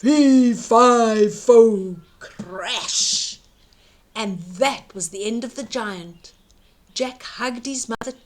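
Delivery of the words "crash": ordinary delivery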